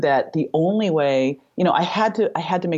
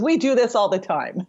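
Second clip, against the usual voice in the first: acted surgeon's assurance, higher pitch; confident reassurance; 8 semitones higher than usual. Surgeon assuring a frightened mother, confident. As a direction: lightly imitate a breezily confident surgeon.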